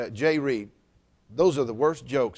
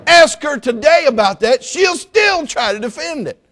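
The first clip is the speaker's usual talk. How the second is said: higher, louder preacher shout; excited shout; 12 semitones higher, 8 dB louder than usual. Real, not acted. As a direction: sell the punchline to the room.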